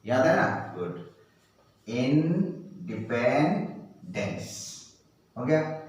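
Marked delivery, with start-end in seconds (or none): 1.9-4.4 slow, broken into sounds; syllable by syllable. Shown as a demonstration. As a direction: sound out each part slowly. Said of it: "make the sound and". (धीरे-धीरे टुकड़ों में)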